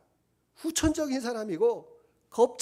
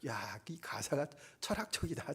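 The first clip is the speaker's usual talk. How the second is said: whispered thought; 6 semitones lower, 9 dB quieter than usual. Retold or imitated. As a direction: whisper it like a private musing. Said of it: "제가 이렇게 생각을 했는데".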